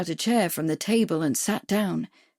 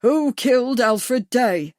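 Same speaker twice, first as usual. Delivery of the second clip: slow, louder booming demand; thundering; 4 semitones higher, 5 dB louder, slower than usual. A narrator's reading. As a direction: bellow the question. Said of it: "he thundered".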